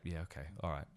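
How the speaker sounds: low, soft embarrassed laughing mutter; sheepish mumble. Retold or imitated. Commentator facing the giggling crowd, sheepish quiet laugh. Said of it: "I was just like".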